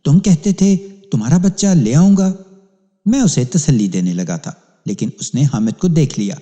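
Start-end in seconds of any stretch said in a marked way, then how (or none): 0.0-2.3 high, raised cry of a desperate mother; mother screaming at the policeman; frantic accusing cry. A narrator's reading. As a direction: wail the broken promise at him. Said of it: "چلانے لگی".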